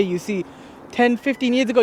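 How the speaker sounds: normal talking voice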